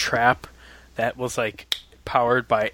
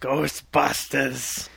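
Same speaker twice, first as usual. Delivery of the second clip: slow drawn-out New York Ghostbusters; New York accent; 3 semitones higher, 3 dB quieter, much slower than usual. Retold or imitated. Host drags out Ghostbusters in mock New York accent. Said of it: "my best New York accent".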